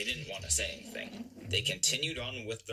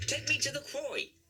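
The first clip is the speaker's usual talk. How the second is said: high, loud barked commands; bossy orders; 3 dB louder than usual. A narrator's reading. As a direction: order the engine around the island. Controller demanding rides.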